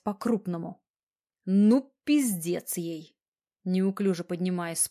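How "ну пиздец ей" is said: slow, menacing growl; low growl. A narrator's reading. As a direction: growl it slowly under the breath. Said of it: "прорычал едва слышно". (медленно, с тихим рычанием)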